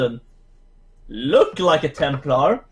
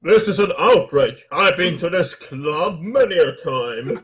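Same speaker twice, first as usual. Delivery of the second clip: indignant snob. Acted. Indignant patron in character.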